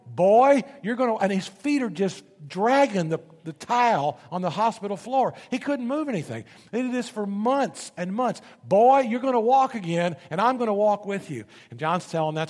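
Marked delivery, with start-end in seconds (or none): 0.1-1.2 loud, high, forceful nurse's urging; booming rally cry to a patient; big nurse. Retold or imitated. Nurse urging a patient who can't walk, loud and commanding. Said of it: "this great big nurse would pick him up and say". 8.6-11.4 loud, high, forceful nurse's urging; booming rally cry to a patient; big nurse. Retold or imitated. Nurse urging a patient who can't walk, loud and commanding. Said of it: "this great big nurse would pick him up and say".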